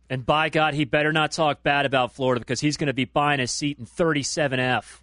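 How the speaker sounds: loud mock coach warning; Urban Meyer impression. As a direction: bellow it like a coach making a threat. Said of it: "Now you're mocking Urban Meyer".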